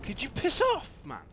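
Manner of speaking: very high, loud plea; exasperated shout. Real, not acted. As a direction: yell at them to leave. Player harassed by enemies.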